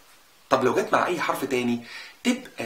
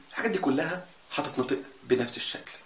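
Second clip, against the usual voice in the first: ordinary talk, nothing marked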